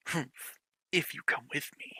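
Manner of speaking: cold, unvoiced hush; cold whisper. The redhead coldly ordering Yuki to follow, whispered. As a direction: whisper it coldly, barely any voice. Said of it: "he said cold".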